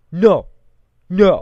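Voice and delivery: loud one-word refusal; blurted defiant no. Retold or imitated. Worker answering boss's tirade, loud defiance. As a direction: imitate a loud, stubborn refusal. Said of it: "the one English word she can say".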